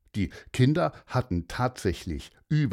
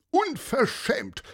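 much higher, slow outraged exclamation; indignant cry; slower than usual. Acted. Paulus crying out about the litter, outraged and much higher. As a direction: draw out the outrage. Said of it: "rief Paulus".

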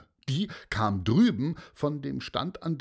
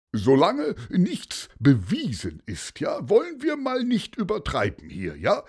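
raised, higher-pitched appeal for moderation; placating objection; 8 semitones higher, 4 dB louder than usual. A narrator's reading. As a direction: rein in an overexcited crowd. Man calms accusing crowd.

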